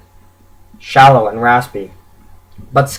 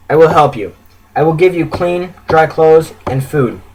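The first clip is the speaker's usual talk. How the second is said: ordinary delivery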